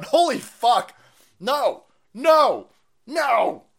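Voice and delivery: high, loud exasperated no's; loud fed-up refusal; exasperated shouting. Real, not acted. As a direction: bark each no in disbelief. Incredulous outburst at quote.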